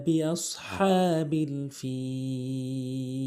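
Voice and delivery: slow, drawn-out chanted recitation; Quran recitation. Shown as a demonstration. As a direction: recite slowly with tajweed melody.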